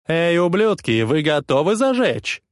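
loud, high crowd-pumping yell; yelling into mic. A narrator's reading. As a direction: hype the crowd like a wild frontman. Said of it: "орёт в микрофон этот псих".

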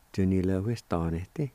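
"Tu ni le hui sta ne te" flowing as one word; joined-up, normal pace. Shown as a demonstration. Teacher giving the whole word for jobs, joined-up normal pace. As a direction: run Tu ni le hui sta ne te together at talking speed. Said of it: "like this".

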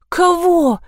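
high-pitched baffled question; uncomprehending. A narrator's reading. Zoya asking her mother, bewildered. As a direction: pitch it up, totally lost. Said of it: "ничего не понимая, спросила Зоя".

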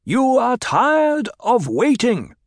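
Paul addressing the crowd, voice carrying. A narrator's reading. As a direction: project it across the cavern. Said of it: "spoke in a voice that carried to the farthest corners".

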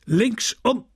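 high barked order; barked drill commands. A narrator's reading. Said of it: "brüllte der Oberst". (hoch gebellter Befehl)

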